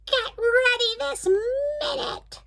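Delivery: high, slow screeching witch; witch scream. Acted. The Blue Witch screaming at Dory, high and drawn out. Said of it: "screamed the Blue Witch".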